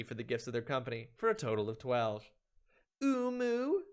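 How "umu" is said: cutesy squeal. Acted. Cute repeat of the boss name.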